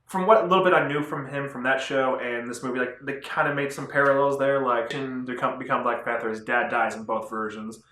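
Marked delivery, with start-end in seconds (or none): none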